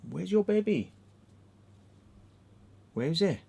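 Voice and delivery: high sing-song questioning; playful high talk to a dog. Real, not acted. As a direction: coax a pet in a high voice. Baby talk to his dog.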